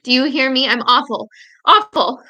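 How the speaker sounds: anguished shout to her dying father; crying shout. Retold or imitated. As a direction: shout it so he hears the vehemence. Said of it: "I want to shout it loud enough".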